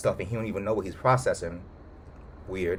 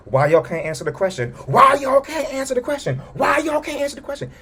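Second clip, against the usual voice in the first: high, loud angry demand, climbing each time; mad boss; 5 dB louder than usual. Retold or imitated. Boss yelling at Wanda and Vision at dinner, mock furious. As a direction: hammer the question at them, rising. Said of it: "the boss start getting mad".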